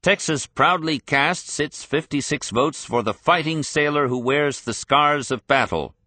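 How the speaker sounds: high, proud convention announcement; a proud, high-pitched floor announcement; proud shout. A narrator's reading. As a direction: call it out to the floor with pride. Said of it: "grabbed the floor microphone and shouted".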